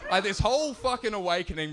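ordinary talk, nothing marked

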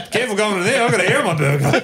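high, worked-up warning; agitated bald stranger. Retold or imitated. Bald man cautioning a passer-by, high warning voice. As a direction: warn them off, pitched high. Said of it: "He goes".

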